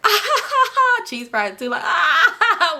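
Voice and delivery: mock cackling laugh. The pranking guys crack up, imitated high cackle.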